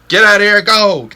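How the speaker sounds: high, loud charging yell; yelling as loud as possible. Retold or imitated. Camper charging the coyotes, loud high yell. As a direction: roar it while sprinting at them. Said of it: "yelling as loud as I could".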